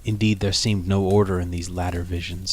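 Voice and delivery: ordinary delivery, nothing marked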